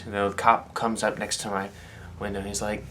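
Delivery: ordinary talk, nothing marked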